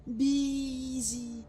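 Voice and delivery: slow echo. Acted. Voice repeating in her memory.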